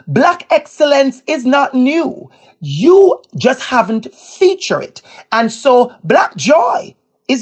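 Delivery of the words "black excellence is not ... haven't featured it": slow, raised-pitch restatement with YOU stretched out; emphatic repeat. Retold or imitated. Speaker repeating the point to the audience, slow and emphatic. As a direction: drive the point home slowly, leaning on YOU. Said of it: "I want to repeat that".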